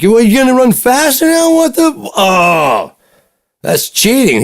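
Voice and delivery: very high, loud squeal of fake outrage, slowing down; playful cry of "cheating", high and loud; mock indignation. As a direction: ham up being cheated.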